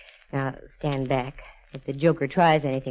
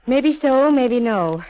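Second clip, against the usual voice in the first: defiant retort; 7 semitones higher, 7 dB louder, much slower than usual. Acted.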